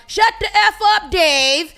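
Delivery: high, loud heckler yell; retold crowd heckle shout. Heckler in the crowd yelling at Dave, loud shout. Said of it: "someone shouted at him from the crowd".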